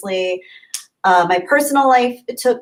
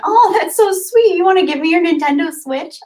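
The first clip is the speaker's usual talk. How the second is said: high excited "so sweet"; delighted gush; 9 semitones higher, 4 dB louder than usual. Real, not acted. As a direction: gush like you just got a gift. Touched by a viewer's offer.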